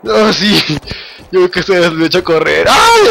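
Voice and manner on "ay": sudden high yelp of fright; startled yelp. Real, not acted. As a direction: jump at a scare. Sudden scare. (grito de sobresalto)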